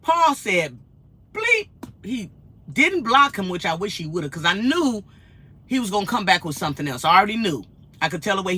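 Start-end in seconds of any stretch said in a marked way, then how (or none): none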